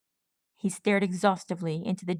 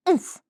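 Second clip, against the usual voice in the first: winded grunt; 10 semitones higher, 4 dB louder than usual. A narrator's reading. Hit in chest.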